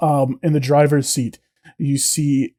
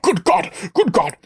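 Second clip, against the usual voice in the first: lightly voiced startled old officer; a hint of a blustery hippo major jolting awake; startled hippo man; 6 semitones higher than usual. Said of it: "the hippo man leans back in his seat with a start and goes".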